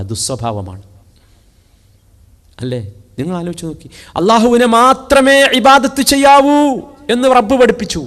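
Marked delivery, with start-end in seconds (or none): 4.2-8.0 preaching at full force. Acted. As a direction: proclaim the verse to the hall. (ഉച്ചത്തിൽ പ്രസംഗം)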